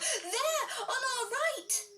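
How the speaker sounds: high excited squeal with a laugh; mock-excited little bear, high. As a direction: point and squeal, high and giggly.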